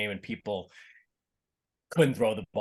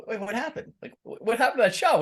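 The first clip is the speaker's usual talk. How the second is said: high, baffled imitation of a flustered player; mock bewildered player; 10 semitones higher than usual. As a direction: act lost over the missed shot. Imitating a rattled pickleball player.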